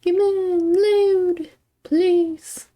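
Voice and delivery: high, slow begging; pleading with a machine. Real, not acted. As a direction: plead with the computer to load. Pleading with Steam to load.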